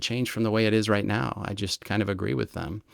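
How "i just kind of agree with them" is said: usual speaking voice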